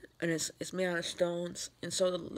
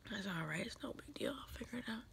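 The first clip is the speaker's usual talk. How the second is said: tearful, near-whispered self-comfort, much softer; crying quietly; 2 semitones higher, 11 dB quieter than usual. Real, not acted. Alone on camera, comforting herself through tears, crying softly. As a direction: hold back real tears and whisper it. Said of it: "And now I'm crying".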